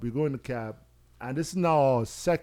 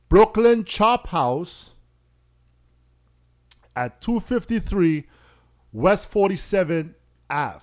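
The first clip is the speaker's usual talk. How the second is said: slow and deliberate; 4 semitones higher, 5 dB louder, slower than usual. Retold or imitated. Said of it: "So understand what I did there".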